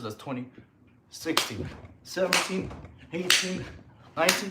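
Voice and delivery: breathless counting during push-ups; out of breath. Real, not acted. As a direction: count between gasping breaths. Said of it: "Counting when I'm out of breath".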